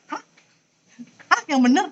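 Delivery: startled, questioning "Hah?"; mock disbelief, voice rising in a question; acted surprise. Teacher acting out someone startled for students, mock surprise. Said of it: "kita kalau kaget".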